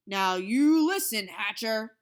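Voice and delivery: loud, slow angry command. A narrator's reading. The boss threatening the father, loud and angry. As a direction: lay down the law. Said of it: "raised his voice".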